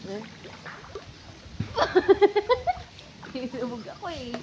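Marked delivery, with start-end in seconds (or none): 0.3-3.4 breathy laugh. Real, not acted. Laughing after slipping.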